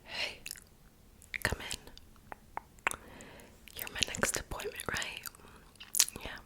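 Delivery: ordinary delivery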